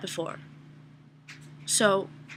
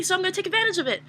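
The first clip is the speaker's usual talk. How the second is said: usual speaking voice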